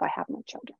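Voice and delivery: hushed, almost voiceless aside; inner thought. Retold or imitated. Client thinking to herself at a reading, hushed. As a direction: murmur it privately to yourself. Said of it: "I'm thinking to myself".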